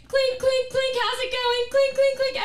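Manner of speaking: high, loud mock clinks; playful clink noises; clinking bottle sound.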